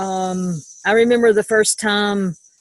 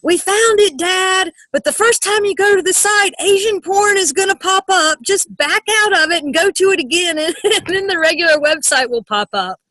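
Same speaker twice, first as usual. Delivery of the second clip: imitating a daughter calling to Dad, high and loud; high-pitched kid calling out across the house; cheerfully hollered instructions, hammed-up; mock yelling up; 10 semitones higher, 3 dB louder than usual. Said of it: "she's yelling up to his office".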